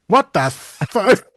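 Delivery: slow, drawn-out shocked "what the fuck"; stunned crowd. Retold or imitated. Crowd greeting the alien, slow shocked disbelief. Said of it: "And everybody goes".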